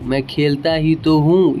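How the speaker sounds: ordinary talk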